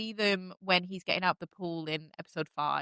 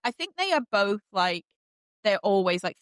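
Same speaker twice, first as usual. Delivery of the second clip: ordinary delivery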